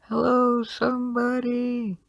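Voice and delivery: high, drawn-out cry for help; yelling for help. Acted. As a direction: call out long and high from a stuck elevator. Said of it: "she's yelling".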